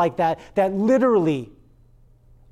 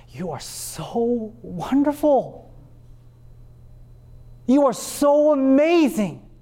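mock flattery; 8 semitones higher than usual. Preacher mocking a flattering God, gushing praise. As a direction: gush over them like a flatterer. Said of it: "God doesn't do that".

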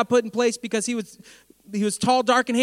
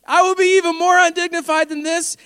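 defiant proclamation; 8 semitones higher, 6 dB louder than usual. Retold or imitated. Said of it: "here it is, ready?".

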